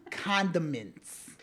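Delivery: CONDIMENTS drawn out very slowly; slow, deliberate CONDIMENTS; slow correction. Pretend. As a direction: stretch CONDIMENTS out syllable by syllable. Correcting the misheard word.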